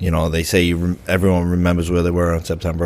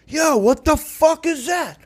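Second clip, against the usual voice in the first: much higher, slow, put-on jeering inmate voice; mocking inmate; slower than usual. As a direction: ham up a rowdy inmate gawking at him. Imitating inmates mocking him.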